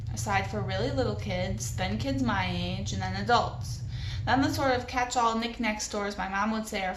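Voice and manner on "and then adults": ordinary delivery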